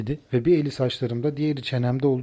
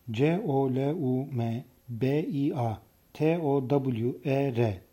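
softer, letter-by-letter spelling; 6 dB quieter, much slower than usual. Shown as a demonstration. Narrator gives spellings of foreign words, slow letter-by-letter. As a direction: spell it slowly, each letter set apart. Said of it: "yabancı kelimelerin yazılışları".

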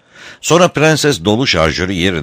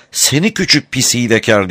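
higher-pitched, understated angry cry; mild narrated shout; 5 semitones higher than usual. A narrator's reading. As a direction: call it out angrily, without real volume. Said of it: "diye bağırdı". (hafif, öfkeli bir bağırış)

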